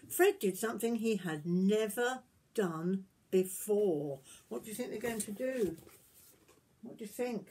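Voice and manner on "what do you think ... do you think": usual speaking voice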